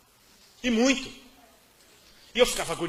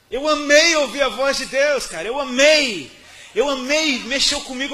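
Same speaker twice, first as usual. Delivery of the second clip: much louder, higher, with passion; fervent; 5 semitones higher, 12 dB louder than usual. Real, not acted. Preacher telling the church he loved it, loud and fervent. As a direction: drive home how much he loved it.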